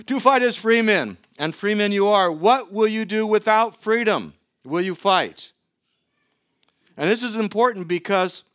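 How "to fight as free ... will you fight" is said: louder, higher battle speech; rally an army before the fight; commander's cry to a ragtag army; rousing speech to troops. Retold or imitated. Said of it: "Picture him riding on that horse with the flag".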